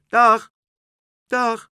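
high, loud farewell call; bright-pitched parting shout; calling goodbye. Acted. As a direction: call a short bye to someone flying off.

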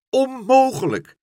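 high, loud wail of despair; desperate cry. A narrator's reading. Father Stamper despairs at the mess, loud cry. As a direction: throw up his arms and cry it out loud. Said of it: "riep hij".

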